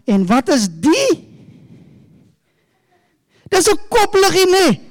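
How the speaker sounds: put-on bright, high storytelling to kids; talking to children. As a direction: coax the little ones like a children's pastor. Talks to children, props.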